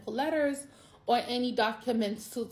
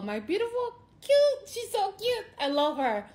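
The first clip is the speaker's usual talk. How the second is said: adoring aunt gushing about her niece; 10 semitones higher than usual. Real, not acted.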